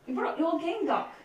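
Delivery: light Scottish accent. Shown as a demonstration. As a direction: say it with a Scottish lilt. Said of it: "except they were my Scottish accent".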